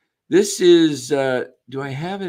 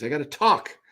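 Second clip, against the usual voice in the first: ordinary talk, nothing marked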